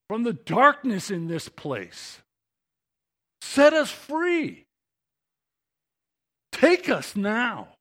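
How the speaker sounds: slow, loud, high plea for rescue; pleading cry. Acted. Preacher voicing the crowd pleading with God, loud and high. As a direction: beg God to come through, slowly. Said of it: "they were pleading with God".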